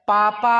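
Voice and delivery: पापा with the final आ held long and loud, like calling across a village; long drawn-out call. Shown as a demonstration. Teacher shows students a child calling papa from afar, आ held long and loud. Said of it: "आ का उच्चारण बहुत देर तक हो रहा".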